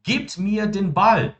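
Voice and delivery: very slow, every German word laid out clearly; slow model sentence. Shown as a demonstration. Teacher modelling German for students, very slow and clear. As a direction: spell the sentence out slowly for the class. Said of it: "quando eu falo".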